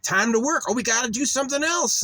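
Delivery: hammed-up imitation of parents ordering work; mock parents. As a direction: bark out chores like a pushy parent. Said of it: "It was like".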